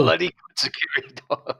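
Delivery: cracking up at his own joke; laughing. Real, not acted. Repeating his guard dog joke to the storyteller, laughing.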